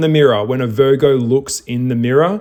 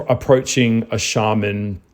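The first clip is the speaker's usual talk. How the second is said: usual speaking voice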